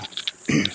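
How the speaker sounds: harsh throat clear after a bug. Real, not acted. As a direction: hack the bug out of your throat. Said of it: "swallowed a bug".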